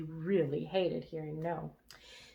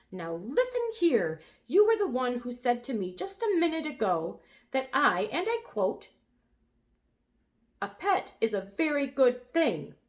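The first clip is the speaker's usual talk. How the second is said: higher, louder, snippy lecturing; 6 semitones higher, 5 dB louder than usual. A narrator's reading. Lulu scolding the brontosaurus, snippy. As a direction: scold him like a know-it-all. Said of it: "in a very snippy voice".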